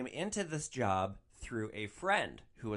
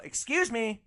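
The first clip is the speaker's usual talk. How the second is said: higher, louder call to get attention; retold yell; 11 semitones higher, 5 dB louder than usual. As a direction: call out sharply, as if through a car window. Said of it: "I yell".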